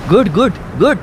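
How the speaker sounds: high, loud urging; eager shout; shouting encouragement. Acted. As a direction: yell good good to spur her on. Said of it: "तो ओरडला".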